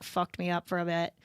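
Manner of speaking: usual speaking voice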